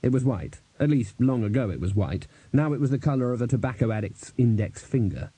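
ordinary delivery

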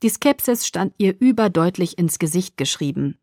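usual speaking voice

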